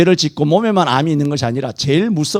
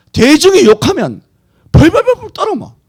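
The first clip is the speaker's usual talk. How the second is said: mocking; 12 semitones higher, 5 dB louder than usual. Mocking followers who tremble.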